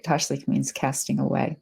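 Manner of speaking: ordinary delivery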